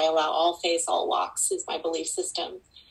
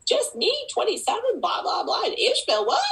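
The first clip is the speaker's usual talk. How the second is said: very high, playful mock disbelief; squeaky-high teasing imitation of amazement; mimicking the student. Mentor echoing her student's story, mock incredulous. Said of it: "when you say".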